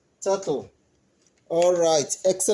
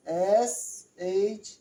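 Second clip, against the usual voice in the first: very slow letter-by-letter spelling; slow, fading spelling drill; 4 dB quieter, much slower than usual. Shown as a demonstration. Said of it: "that's how you spell it".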